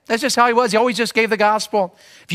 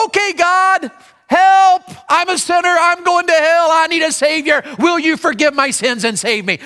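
higher, louder cry for help; urgent plea; 7 semitones higher, 3 dB louder than usual. Acted. Preacher acts a sinner calling on the Lord, higher and louder. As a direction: call out to God in need.

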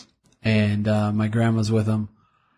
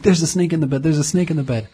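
high, urgent warning, not shouted; mild retold panic; 6 semitones higher, faster than usual. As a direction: imitate a sleepwalker's alarm, pitched up. Retelling sleepwalking panic to wife.

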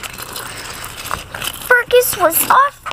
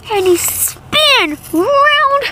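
usual speaking voice

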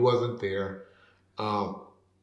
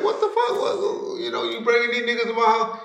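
much higher, shocked indignant cry; outraged retold shriek; 10 semitones higher than usual. Woman upset that gang members came to her house, mock outraged shriek. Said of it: "so she was like".

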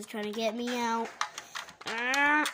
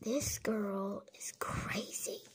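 hushed whisper aside; 2 semitones lower, 6 dB quieter, slower than usual. Pretend. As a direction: whisper it under your breath. Whispered aside while sneaking.

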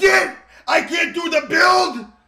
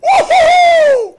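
high, loud whoop of triumph; gleeful high-pitched holler; victory whoop; 13 semitones higher, 7 dB louder than usual. Real, not acted. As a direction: cheer it out, way up high. Celebrating a kill.

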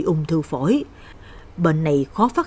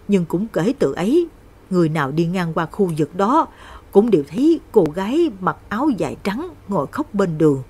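usual speaking voice

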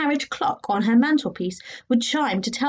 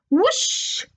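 high whoosh; 10 semitones higher than usual. A narrator's reading. Blown out of window.

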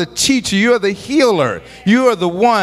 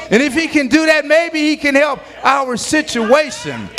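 high preacher's build; fervent sermon climb; preaching at full force; 4 semitones higher than usual. Acted. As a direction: lift each line toward the shout.

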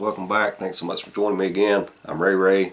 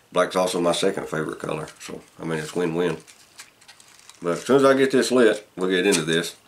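talking around pipe stem; words through clenched teeth; pipe in mouth. Real, not acted. Seen 3: Pipe stem clenched in teeth throughout labelled words; absent in references.

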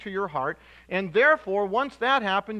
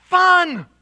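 "fun." shouted high and loud; stress on fun; 10 semitones higher, 12 dB louder than usual. Shown as a demonstration. Preacher exclaiming giving should be fun, loud. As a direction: punch "fun." with a joyful shout. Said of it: "Giving should be".

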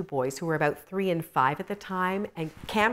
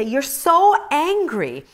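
slow, higher, louder mimic of husband; husband's reproach; 9 semitones higher, 6 dB louder, much slower than usual. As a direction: stretch it out, mocking his words. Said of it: "and he would just say".

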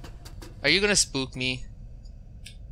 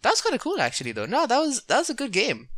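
excited; 11 semitones higher, 5 dB louder than usual. Real, not acted. Excited after ending.

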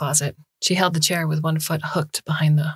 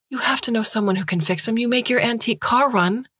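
put-on higher, desperate young woman begging for help; pleading student; 7 semitones higher than usual. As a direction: beg the doctor, voice rising. Han pleading again.

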